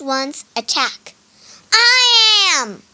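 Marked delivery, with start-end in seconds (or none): 1.7-2.8 loud, high "I" falling away; shouted. A narrator's reading. Rival Wukong claims to be real, shouting. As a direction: shout the claim back at him. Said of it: "shouted the other Wukong".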